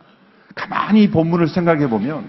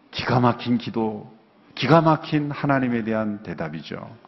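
ordinary talk, nothing marked

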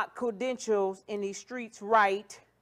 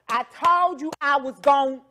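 louder, mock emphatic delivery; imitated way of talking; 3 semitones higher, 10 dB louder than usual. Host mocking how people talk, loud exaggerated imitation. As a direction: mimic people making a big announcement. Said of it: "when I see people talk like that".

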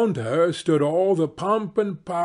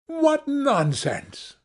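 high, slow scoffing exclamation; scornful; 12 semitones higher, slower than usual. A narrator's reading. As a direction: dismiss him with contempt. Wife scoffs at husband.

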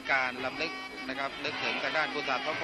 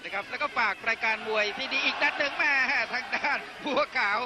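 excited boxing commentary; 7 semitones higher than usual. Real, not acted. Live knockdown call in ring.

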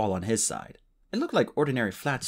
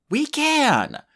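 loud, high, fierce cry; 6 dB louder than usual. Acted. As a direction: snap it back with fire. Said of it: "Tsunami said fiercely".

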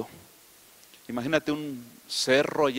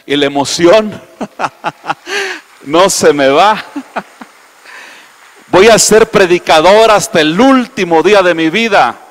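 jubilant shout with laughter, much louder; preaching at full force; 5 semitones higher, 15 dB louder than usual. Acted. Preacher declaring lifelong calling to congregation, laughing and shouting. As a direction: declare it with joyful fire.

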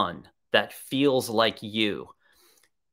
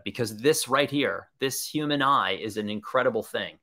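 ordinary talk, nothing marked

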